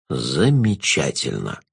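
low, slow, velvety jester; sinister jester. Acted.

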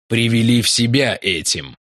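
usual speaking voice

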